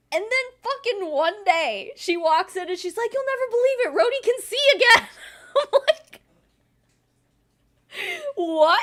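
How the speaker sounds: gleeful astonished retelling; excited. Real, not acted. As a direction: build up to the shock.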